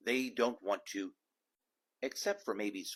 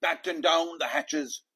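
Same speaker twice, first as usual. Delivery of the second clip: loud, higher command; storm order; 5 semitones higher, 7 dB louder than usual. A narrator's reading. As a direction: bark it over the wind.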